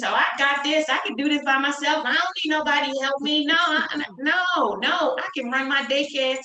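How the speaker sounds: high, loud, brash I-can-do-it-alone voice; cocky self-quote. Retold or imitated. New business owner brushing off help, brash and high. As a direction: puff up with overconfidence.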